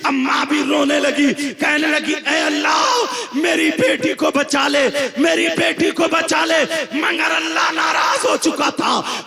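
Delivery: loud, driving sermon over music; fiery preaching. Acted. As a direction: thunder the warning at the congregation. Preaching at full force.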